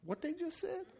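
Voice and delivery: hushed, high-pitched incredulous question; stunned disbelief. Acted. Anyone overhearing something stupid, quietly incredulous. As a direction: drop the volume and squeak it in disbelief. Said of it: "somebody says something stupid and you're like".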